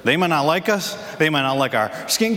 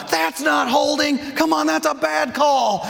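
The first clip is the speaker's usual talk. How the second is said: put-on upset young daughter; 13 semitones higher, slower than usual. Daughter in the stands yelling at the ref, high and upset. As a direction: shout like a ten-year-old furious in the stands. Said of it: "she's upset about it".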